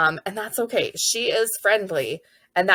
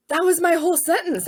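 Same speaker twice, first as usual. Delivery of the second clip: put-on higher, bright child voice; proud excited kid; 11 semitones higher, 4 dB louder than usual. Kindergartener proud to finish a sentence, excited. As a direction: sound thrilled with yourself.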